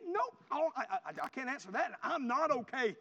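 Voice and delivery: high, stammered refusal; loud, worked-up mock distress; upset, crying retelling. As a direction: act overwrought and unable to answer. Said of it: "Are you okay?".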